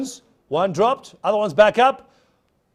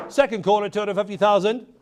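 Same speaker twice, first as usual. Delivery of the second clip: usual speaking voice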